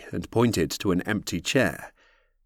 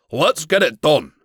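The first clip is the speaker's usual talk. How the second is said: barked; 6 semitones higher, 6 dB louder than usual. A narrator's reading. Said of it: "Rogar barked".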